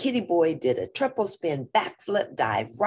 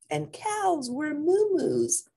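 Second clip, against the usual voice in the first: sister voice; 12 semitones higher, slower than usual. Acted. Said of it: "added Jujubee".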